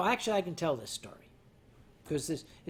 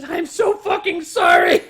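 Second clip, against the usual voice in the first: much higher, louder mock sorry; exaggerated apology; 12 semitones higher, 15 dB louder than usual. Translating the German apology for viewers, a loud, hammed-up sorry. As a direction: blurt out the frantic apology. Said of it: "which is".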